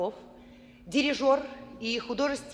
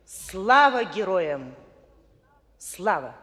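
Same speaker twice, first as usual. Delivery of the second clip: slow, solemn, ringing proclamation; rally speech; 3 semitones lower, 4 dB louder, slower than usual. Acted. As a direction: declaim it slowly like a memorial rally.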